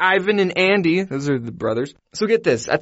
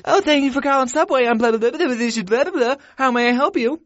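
high, mocking phone-greeting voice; stupid voice; 9 semitones higher than usual. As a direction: ham up a bored worker answering the phone. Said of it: "with their stupid voice".

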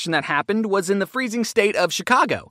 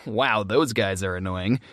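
low, slow, dry judgment; annoyed thought; 12 semitones lower, 3 dB quieter than usual. Retold or imitated. Narrator judging a chanting group, dryly annoyed. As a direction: think it aloud, low and slow. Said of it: "And I thought".

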